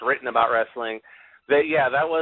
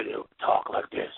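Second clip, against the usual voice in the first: soft, unvoiced whispery villain voice; evil mysterious voice; 6 dB quieter than usual. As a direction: imitate a hushed, mysterious villain. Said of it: "supposed to be evil and mysterious".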